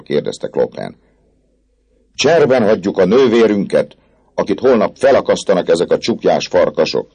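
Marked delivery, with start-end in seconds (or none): 2.1-7.1 indignant outcry. Acted. Vagabond protests abandoning sister.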